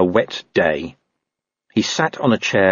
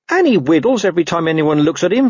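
higher-pitched, mildly snappish dog; snapped retort; 9 semitones higher, 4 dB louder than usual. A narrator's reading. Dog running down his rival, mildly snappish. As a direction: snap it back scornfully. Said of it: "snapped Gaspode".

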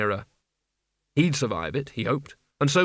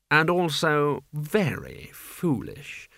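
very slow, drawn-out menace; unhurried villainous nobleman; haughty Duke; much slower than usual. A narrator's reading. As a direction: stretch each word with cool condescension. The Duke sneers at Mort.

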